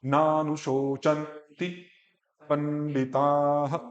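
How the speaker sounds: नानुशोचन्ति पंडिताः joined in one slow flow; said together slowly. Shown as a demonstration. Teacher asks student to say नानुशोचन्ति पंडिताः together, slowly. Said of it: "अब इकट्ठा बोलो".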